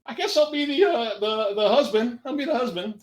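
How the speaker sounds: high, meek little voice; timid hesitant answer. Acted. Acted timid answer to huge cellmate.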